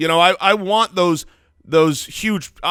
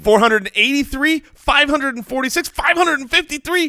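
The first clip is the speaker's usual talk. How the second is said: numbers climbing higher and higher in pitch; building excitement; 9 semitones higher than usual. Shown as a demonstration. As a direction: step each figure up, rising with the trend.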